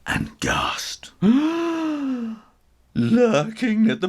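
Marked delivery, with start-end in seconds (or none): 1.2-2.4 loud shocked gasp; high gasp. A narrator's reading. Kittens gasping at the huge new kitten, high. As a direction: gasp in alarm.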